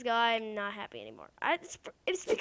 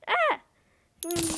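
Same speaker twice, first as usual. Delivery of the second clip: ordinary delivery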